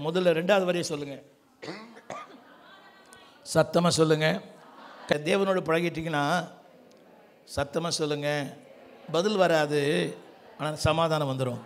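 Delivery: ordinary talk, nothing marked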